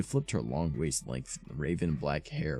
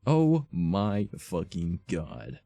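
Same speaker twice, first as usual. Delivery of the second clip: slow, louder, drawn-out mock scream; hammed-up scream; 3 semitones higher, 6 dB louder, much slower than usual. Raven hearing about the concert, in a slow mock scream. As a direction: stretch every word like a fangirl. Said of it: "I screamed".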